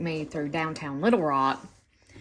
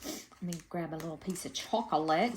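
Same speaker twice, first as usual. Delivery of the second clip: ordinary talk, nothing marked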